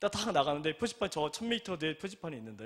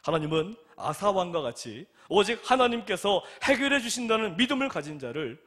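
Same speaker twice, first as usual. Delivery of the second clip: climactic preaching; 2 semitones higher, 6 dB louder than usual. Acted. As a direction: stretch each phrase like a final appeal. Closing sermon climax.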